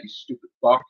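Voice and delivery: ordinary talk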